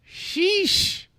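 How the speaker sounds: very high, squeaky sheesh; exasperated sheesh. A narrator's reading. Narrator at the wrong stop, a squeaky exasperated sheesh. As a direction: throw up your hands at it.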